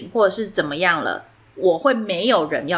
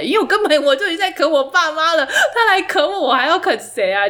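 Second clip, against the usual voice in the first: laughing; 7 semitones higher than usual. Real, not acted. Laughing at own absurd situation.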